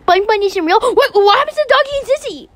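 high, distressed questioning; acted alarm. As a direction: panic upward, rushing the last question. Character learns Bunny isn't real.

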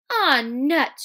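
acted impatient dismissal; exasperated. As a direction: throw it out in mild exasperation.

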